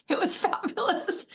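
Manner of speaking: a teary laugh breaks through "fabulous"; laughing through tears. Real, not acted. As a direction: laugh while still choked up. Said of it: "Didn't expect that to overcome me".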